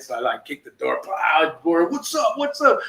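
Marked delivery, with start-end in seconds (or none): 1.1-2.8 high, winded mock bravado; out of breath. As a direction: act tough while gasping for air. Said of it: "really just out of breath".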